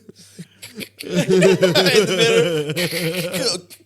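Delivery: loud, high, choking cackle; real laughter breaking into repeated catches; helpless laughing fit. Real, not acted. Podcast guest cracking up at friend's act, helpless loud cackle. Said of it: "Why do I sound like the".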